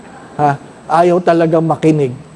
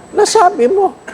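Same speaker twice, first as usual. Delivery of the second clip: much higher, playful falsetto; squeaky mock voice; 13 semitones higher than usual. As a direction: tease the crowd in a silly high voice. Playful mock reply in sermon.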